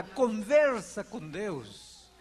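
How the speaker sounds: stretched out, each word weighed; slow emphatic urging. Real, not acted. Pastor urging the congregation to talk with God, slow and emphatic. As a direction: lean on every word, slowly. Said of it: "preacher urging".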